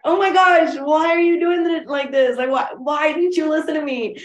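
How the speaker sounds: high, exclaiming mock scolding; judgy. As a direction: imitate a picky wedding guest complaining, high-pitched. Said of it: "She's a judgy wedding guest".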